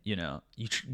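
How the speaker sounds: ordinary talk, nothing marked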